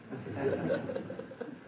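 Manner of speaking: soft breathy chuckle; quiet mischievous laugh; sly laugh. Real, not acted. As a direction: laugh at your own trick. Laugh at his own trap.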